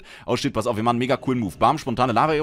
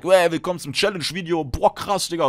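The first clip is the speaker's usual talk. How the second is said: mock YouTuber intro; 6 semitones higher than usual. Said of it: "tue ich so, als wäre es eine Challenge".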